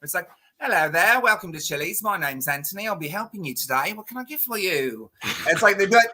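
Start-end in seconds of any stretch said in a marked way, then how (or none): 0.6-5.1 chirpy British waiter, slightly higher; a light British accent greeting diners; mock British accent. Server greeting a Chili's table in a mock British accent. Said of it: "I would have British days".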